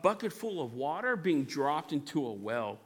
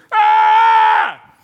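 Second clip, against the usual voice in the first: very high, loud shrieking scream; amygdala panic scream; 19 dB louder than usual. Acted. Amygdala screaming in panic at the frontal lobe's plan, shrill yell. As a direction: scream like a panicked brain part. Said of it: "the amygdala's going".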